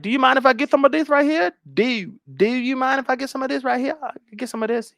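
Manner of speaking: high-pitched mock eager guest; [name]. Guest asking the host for a drink, high-pitched mocking impression. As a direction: ask high and sing-song. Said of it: "She's like".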